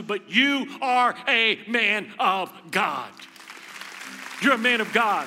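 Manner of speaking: slow loud declaration; slow preaching proclamation; proclaiming. Acted. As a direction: drive it home slowly. Sermon climax.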